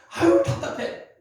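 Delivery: acted exasperation, loud and high; exasperated. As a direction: drag out a frustrated groan of words. Acting out someone who can't explain.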